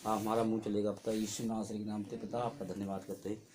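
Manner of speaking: low, hushed prayer of thanks; subdued, murmured devotional prayer. Real, not acted. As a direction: pray softly to the Father, voice kept low. Opening prayer before the testimony.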